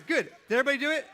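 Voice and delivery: loud question to the whole room; shouting out. Real, not acted. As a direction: call it out over the room. Calling out over the noise.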